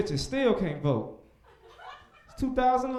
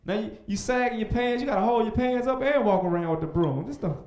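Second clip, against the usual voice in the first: ordinary talk, nothing marked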